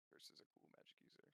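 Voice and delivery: normal talking voice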